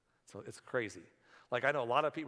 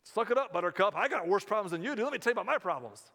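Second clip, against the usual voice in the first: loud, scornful, higher; mock one-upping; 5 semitones higher, 5 dB louder than usual. Preacher mocking a self-centred relative, loud and scornful. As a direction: brush off their trouble with a bragging sneer. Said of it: "You don't have to sit there and say".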